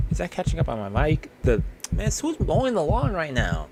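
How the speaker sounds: ordinary talk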